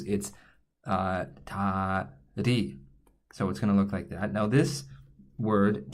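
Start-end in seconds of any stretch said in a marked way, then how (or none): 1.4-2.8 "ta ri" drawn out slowly with a flapped R; slow careful Korean "ta ri", between R and L; Korean tari. Shown as a demonstration. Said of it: "it's neither of those".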